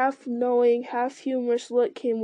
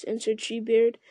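usual speaking voice

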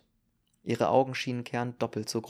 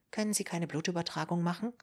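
higher, quick and thin plea; young woman's voice; 7 semitones higher, 3 dB quieter, faster than usual. Acted. Ruth pleading from the doorway, high and quick. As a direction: ask anxiously in a lifted woman's voice.

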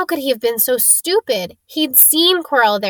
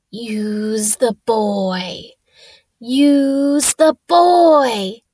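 slow, drawn-out ghostly whisper-voice; eerie second voice; 4 dB louder, much slower than usual. Acted. Voldemort's voice speaking through Quirrell, slow and eerie. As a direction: let a strange voice speak through him, very slowly. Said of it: "a voice answered".

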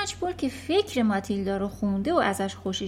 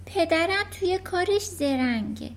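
put-on higher, polite small-girl voice; little girl; 9 semitones higher than usual. Matilda answering the headmistress, polite and small.

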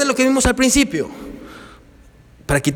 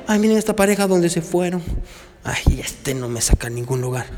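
lower, softer envious complaint; mock jealous wife; 3 semitones lower, 5 dB quieter than usual. Pastor voicing a wife scrolling Facebook, softly complaining. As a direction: sigh it out like a neglected wife.

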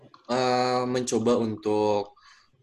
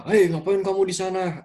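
higher stern call across a silent hall; stern disciplinarian yell; 9 semitones higher than usual. Retold or imitated. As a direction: bark at a whispering student like a strict drill prefect. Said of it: "terus gue teriak".

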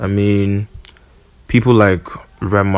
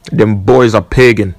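London roadman slang, louder; UK roadman accent; 5 dB louder than usual. Pretend. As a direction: street London accent, punchy. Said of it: "Say this in the most U K roadman accent way".

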